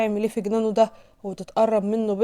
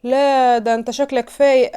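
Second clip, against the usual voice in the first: raised, louder mocking disbelief; scornful; 5 semitones higher, 7 dB louder than usual. A narrator's reading. Man laughing off her protest, mockingly incredulous. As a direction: scoff at her as if she's lying. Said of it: "يضحك عليها ويقول لها".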